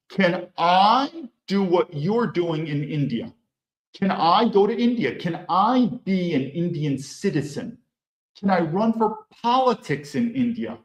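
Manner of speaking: ordinary delivery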